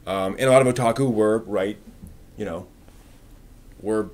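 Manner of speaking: ordinary delivery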